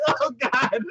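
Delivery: high squeal breaking into laughter; high, laughing groan; amused dismay. Retold or imitated. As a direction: react in giggly mock horror. Speaker's acted reaction, breaking into laughter.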